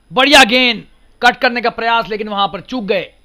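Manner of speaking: sudden loud, high burst on a near edge; excited cricket commentary. Real, not acted. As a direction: burst out at the play and miss.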